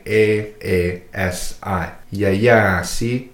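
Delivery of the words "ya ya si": "ya ya si" with the second "ya" held long; slow "ya ya si", long middle vowel; dragged vowel. Shown as a demonstration. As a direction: drag out the second "ya" in "ya ya si". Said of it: "you could hear how it's dragged".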